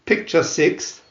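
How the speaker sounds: plain reading voice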